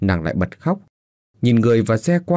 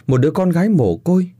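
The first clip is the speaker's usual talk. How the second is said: lament; 8 semitones higher than usual. Acted. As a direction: cry it out over the child. Mother weeping to daughter. (mẹ khóc than với con gái)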